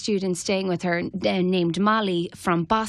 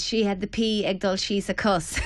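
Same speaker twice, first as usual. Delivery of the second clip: ordinary talk, nothing marked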